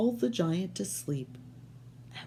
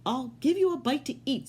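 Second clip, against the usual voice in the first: higher, lightly voiced wife; giant's wife; 8 semitones higher than usual. Wife offering Jack food, lightly higher voice. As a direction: offer it kindly, a bit higher. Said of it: "said the giant's wife".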